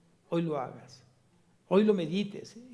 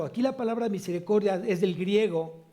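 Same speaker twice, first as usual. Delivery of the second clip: ordinary talk, nothing marked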